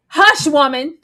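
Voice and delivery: high, loud dismissive mock bark. Sam silences the women, loud mock scorn. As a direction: slap them down with scorn. Voicing Sam's retort.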